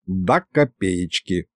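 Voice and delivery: low, soft, slow assurance; confirming. A narrator's reading. Grandfather vouches for the accused demon, quietly confirming. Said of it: "подтвердил Михалыч".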